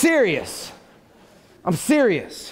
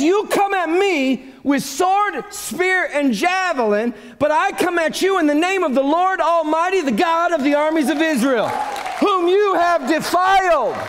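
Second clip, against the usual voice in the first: higher, ringing declaration of challenge; confident David; 7 semitones higher than usual. Acted. David challenging Goliath in God's name, confident shout. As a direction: proclaim it boldly, building to the last phrase. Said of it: "Dude has got a strong confidence".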